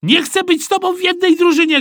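very high, loud protest; shrieked boyish refusal; shouting. A narrator's reading. Boy rejecting his teammate, shouting. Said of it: "krzyknął Joachim".

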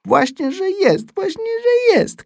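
piskliwie wysoki, głośny dziecięcy protest; płaczliwy krzyk. A narrator's reading. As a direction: upieraj się krzykiem, jak płaczące dziecko. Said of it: "krzyknąłem". (po polsku: płaczliwe, przeciągłe wykrzykiwanie)

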